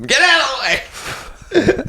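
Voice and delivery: very high-pitched pretend yell; mock PA yell. Yelling over the track PA as a joke, high mock yell. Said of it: "Yelling in the P A system".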